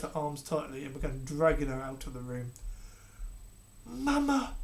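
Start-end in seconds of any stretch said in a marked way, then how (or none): 3.8-4.6 put-on boy's cry. A narrator's reading. Prince to his mother, high desperate cry. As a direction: cry out as she's dragged off. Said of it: "cried Alfred".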